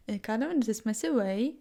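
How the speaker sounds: ordinary talk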